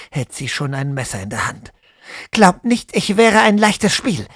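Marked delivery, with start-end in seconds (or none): 2.3-4.3 loud, high, self-assured warning; confident hiss. Acted. Woman with knife warns both men, confident loud hiss. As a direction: hold the knife and warn them off. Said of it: "zischt sie, selbstbewusst".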